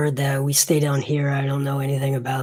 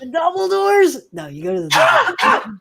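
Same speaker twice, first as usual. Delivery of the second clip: very high, squeaky-pitched mock alarm; hammed-up disbelief; 14 semitones higher, 4 dB louder than usual. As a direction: push the disbelief up into comic falsetto. Mock disbelief peaks, ends in laughter.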